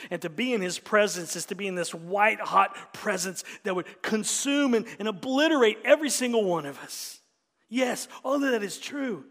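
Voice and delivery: loud, rising sermon climax; full-force preaching. Acted. Pastor proclaims Jesus' holiness, full-force preaching. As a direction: thunder it from the pulpit.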